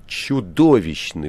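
loud, drawn-out verdict; bold, frank. A narrator's reading. Ivan judges his own poems, bold and frank. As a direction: say it boldly, stretching the word. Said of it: "вдруг смело и откровенно произнёс Иван".

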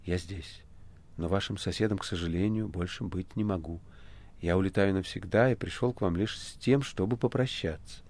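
soft, subdued speech; gentle farewell. A narrator's reading. The Master tells Ivan he is leaving, softly. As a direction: break the news softly.

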